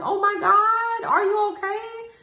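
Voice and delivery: imitating a panicked high shriek; mock-panicked cousin, high and loud; cousin freaking out. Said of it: "My cousin is freaking out".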